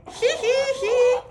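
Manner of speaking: high-pitched delighted giggle. Real, not acted. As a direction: giggle at the cute bunny. Giggling at the bunny.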